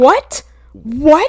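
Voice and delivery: loud rising disbelief; can't believe what he just heard; incredulous shout. Real, not acted. Reacting loudly to the nurse's absurd question.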